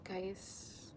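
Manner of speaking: breathy sigh, whispered; hushed whisper after a sigh. Real, not acted. Sighing whisper to camera.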